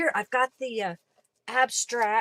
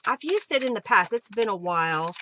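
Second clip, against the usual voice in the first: ordinary talk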